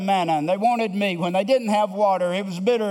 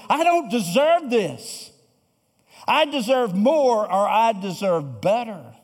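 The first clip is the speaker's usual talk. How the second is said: entitled grumbling; 2 semitones higher than usual. Retold or imitated. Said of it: "but this is our attitude".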